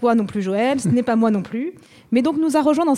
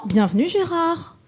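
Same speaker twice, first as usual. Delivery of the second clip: Gérard sung out much higher; redone welcome, name pitched up; 7 semitones higher, slower than usual. Shown as a demonstration. Host redoing the welcome for Gérard, name pitched up. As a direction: lift the name up high on the retake.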